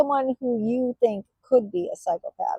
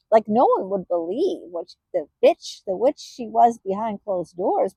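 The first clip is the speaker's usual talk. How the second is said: ordinary talk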